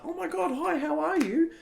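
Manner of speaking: very high, squeaky excited dog voice; happy dog. Acted. Happy dog greeting its owner at the door, high excited voice. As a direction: greet them as an overjoyed pup. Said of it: "the dog is all happy to see you".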